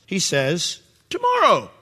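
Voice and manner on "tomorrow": proud Pharaoh. Retold or imitated. Preacher mocking proud Pharaoh's smug high reply. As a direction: ham up Pharaoh's smug stalling. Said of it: "absurdly proud response".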